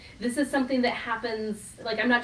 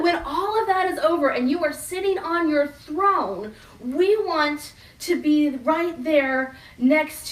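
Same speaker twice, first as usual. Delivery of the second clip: louder, higher, slowing on "right there next"; eager disciples; 6 semitones higher, 5 dB louder than usual. Retold or imitated. Disciples insisting to Jesus on sitting beside his throne. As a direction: press the claim for the seat. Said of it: "they're all thinking".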